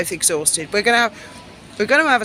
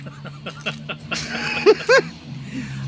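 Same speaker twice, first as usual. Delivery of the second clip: breathy high laugh; 9 semitones higher, 5 dB quieter than usual. Real, not acted. Laughs after ransom joke.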